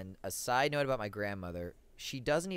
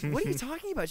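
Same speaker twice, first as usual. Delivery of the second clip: shocked; 14 semitones higher, 4 dB louder, faster than usual. Retold or imitated. Shocked reply to grandma.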